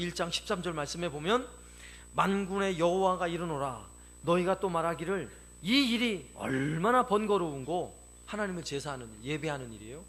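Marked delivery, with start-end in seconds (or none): 2.2-8.0 slow, loud preacher reading; ringing, stretched Bible reading; scripture declamation. Acted. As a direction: proclaim the verse with drawn-out emphasis.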